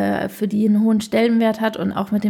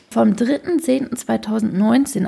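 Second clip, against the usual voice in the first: ordinary talk, nothing marked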